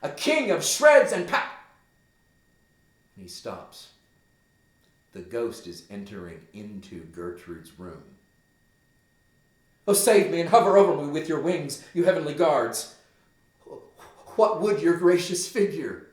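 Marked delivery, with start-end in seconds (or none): 0.0-1.5 much higher and louder, furious outburst; Hamlet raging. Acted. Teacher performs Hamlet's raging speech. 9.9-16.0 loud, higher, fast cry for heavenly help; Hamlet terrified. Acted. Hamlet cries out at the ghost.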